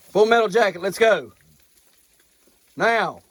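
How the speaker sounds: high, loud summons; calling an animal. Real, not acted. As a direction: holler the animal in, firmly. Calling a goat by name.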